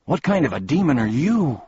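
high, loud challenge; indignant. A narrator's reading. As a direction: demand an answer indignantly. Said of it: "said Milo indignantly".

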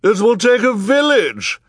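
high, loud shrieking cry; piercing high-pitched yell. A narrator's reading. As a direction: shriek it out over a crowd. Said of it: "shrieked Missus Morrow".

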